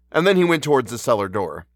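ordinary delivery

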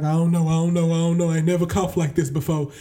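mock bewildered worrier, laughing; clueless sick person. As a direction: play someone baffled by their own cough. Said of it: "you're just like".

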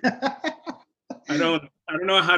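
real laughter through the words; laughing. Real, not acted. Host laughing as he corrects his friend about the dogs, laughing. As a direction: giggle while starting the line.

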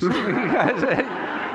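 high and laughing. Real, not acted. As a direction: laugh it out to the fans. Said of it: "I didn't mean any of you guys".

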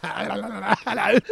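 laughing hello. Real, not acted.

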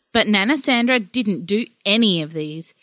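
ordinary talk

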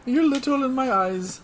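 high, bossy Santa voice; bossy Iggy Claus. Acted. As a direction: boss the elves around like Santa. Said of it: "Iggy Claus".

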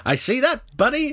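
high mock-American with rising end; inauthentic American accent. Quoting the train engineer's supposed words, high mock American accent. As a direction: repeat it in a put-on American twang. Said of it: "American accent there".

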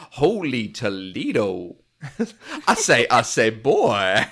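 loud, slow hammed-up American accent, laughing through it; laughing mock American accent. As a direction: ham up a fake American drawl. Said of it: "It is a terrible accent".

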